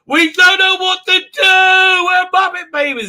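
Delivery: high, loud, slow hammed-up wail; frantic helpless yelling; mock panic wail. Crew panicking.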